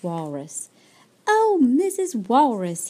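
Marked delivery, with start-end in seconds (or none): 1.3-2.9 loud, high call of a little bird; eager cry. A narrator's reading. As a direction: call out hopefully, louder and higher. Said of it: "he cried".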